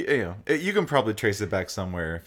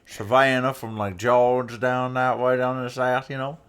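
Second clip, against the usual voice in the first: Savannah accent; 4 semitones higher, 4 dB louder than usual. Shown as a demonstration. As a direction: try on a Savannah, Georgia accent. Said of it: "something more like a Savannah".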